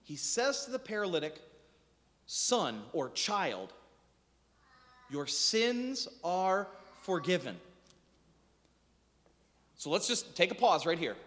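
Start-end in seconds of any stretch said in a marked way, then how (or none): 2.3-7.6 slow, soft and lower, like a gentle father; tender blessing. Acted. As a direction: let the forgiveness land slowly and softly. Jesus forgives the paralytic.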